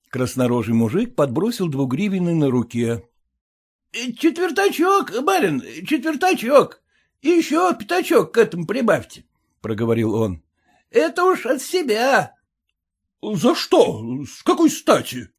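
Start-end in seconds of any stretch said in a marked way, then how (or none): 3.5-12.3 wheedling peasant. A narrator's reading. Peasant begging the gentleman for a tip, higher wheedling voice. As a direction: coax a quarter out of the gentleman.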